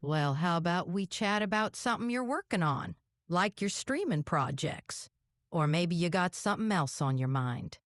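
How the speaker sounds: Southern mountain twang; hillbilly accent. Pretend. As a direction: keep up the hillbilly girl accent. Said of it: "You're a hillbilly girl".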